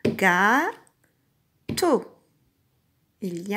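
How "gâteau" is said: "Gâteau" as two separate syllables, long pause between. Shown as a demonstration. Teacher taps "Gâteau" together with the child, syllable by syllable. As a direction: draw out "gâ" with a rise, pause, then a short "teau". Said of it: "on le tape ensemble".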